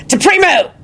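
high, forceful yell; yelled spell. A narrator's reading. Lupin casts at attackers on the hill, yelling. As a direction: hurl the incantation out at high pitch. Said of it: "Lupin yelled and flung his wand".

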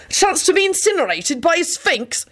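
high-pitched frantic disbelief; desperate. A narrator's reading. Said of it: "Benji said desperately".

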